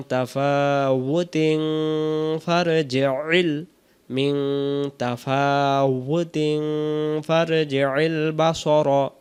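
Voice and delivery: তাফাউতিন linked on into ফারজি'ল, slowly; joined without stopping. Shown as a demonstration. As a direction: carry তাফাউতিন straight into ফারজি'ল. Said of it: "তাহলে কিন্তু এমন হবে". (না থেমে তাফাউতিন ফারজি'ল মিলিয়ে)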